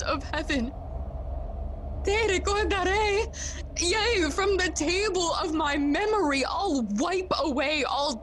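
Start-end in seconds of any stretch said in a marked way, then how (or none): 2.0-6.4 high, fervent promise; pitched-up, heated pledge; impassioned vow. Acted. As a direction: swear it with urgent fervour. Hamlet vows to remember the ghost.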